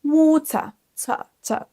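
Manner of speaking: British water stopping on a schwa, then ta, ta; UK accent, no R. Shown as a demonstration. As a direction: end water on tuh, British style. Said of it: "s'arrêter sur un son E et pas de R".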